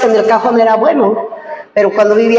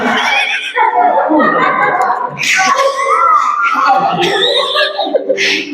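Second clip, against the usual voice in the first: laugh; 6 semitones higher than usual. Real, not acted. Laughing at the taxi story.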